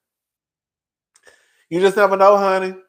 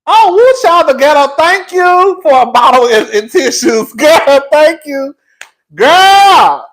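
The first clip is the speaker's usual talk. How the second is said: laughing through tears; 10 semitones higher, 11 dB louder, slower than usual. Real, not acted. Laughing-crying, thanking for tissues.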